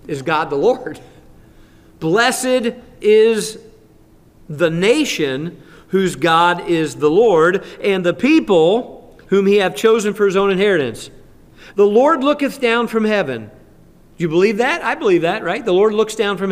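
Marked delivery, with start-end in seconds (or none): 2.0-13.5 higher, louder, very slow on the key line; scripture reading. Acted. Pastor proclaims Psalm 33 to the church, higher and louder. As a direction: proclaim the verse with weight.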